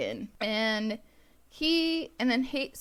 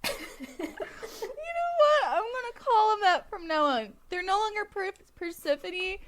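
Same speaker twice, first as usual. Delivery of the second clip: high, laugh-cracked voice; words squeezed out through laughter; crying with laughter; 9 semitones higher than usual. Real, not acted. Laughing fit over name mix-up.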